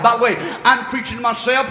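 ordinary talk

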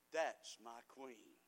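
the king delighted to see her; admiring king. Acted. Voicing the king admiring Esther.

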